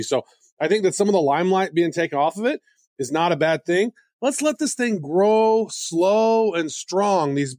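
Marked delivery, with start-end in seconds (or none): 4.2-7.3 slow and strong. Acted. Slow drawn-out wish.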